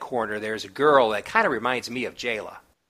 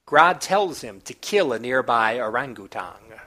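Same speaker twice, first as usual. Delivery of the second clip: ordinary delivery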